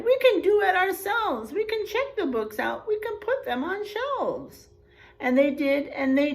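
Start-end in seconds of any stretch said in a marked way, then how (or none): 0.0-4.7 high, eager cheering voices; animals crying out. Acted. Zoo animals proudly cheering to the reader, high and excited. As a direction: call it out like proud little animals. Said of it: "Then the animals cried".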